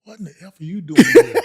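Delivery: incredulous yell. Retold or imitated. Huddle teammates to the unexpected substitute, loud incredulous yell. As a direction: imitate teammates yelling in astonishment.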